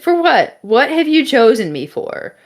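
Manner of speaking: hammed-up bewildered plea; incredulous questioning. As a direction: demand an answer in comic disbelief. Acted back-and-forth with the voice.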